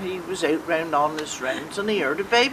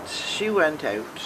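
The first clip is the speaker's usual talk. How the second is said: ordinary delivery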